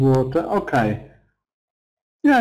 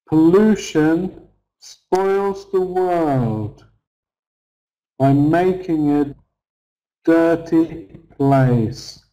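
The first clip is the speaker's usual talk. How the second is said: slow, hitting "Pollution", "spoils", "world" and "dirty"; slow stress drill, each content word leaned on; stressed syllables; slower than usual. Shown as a demonstration. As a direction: punch the nouns, verb and adjective, slowly. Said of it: "These are the stressed syllables".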